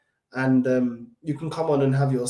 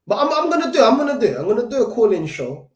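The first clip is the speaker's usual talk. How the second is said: excited promise; 7 semitones higher, 5 dB louder, faster than usual. Real, not acted. Promising a call-in show.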